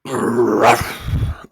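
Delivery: gruff barked Ruff; dog bark. Acted. Watchdog barking at Milo, dog bark. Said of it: "I don't know if he's barking".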